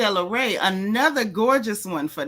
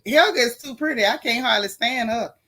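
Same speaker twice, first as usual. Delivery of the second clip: normal talking voice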